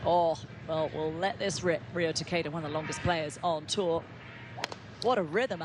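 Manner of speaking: ordinary talk, nothing marked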